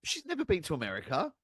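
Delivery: high-pitched, incredulous protest; incredulous insistence. Real, not acted. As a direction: hammer the point in disbelief. Insisting the accent makes no sense.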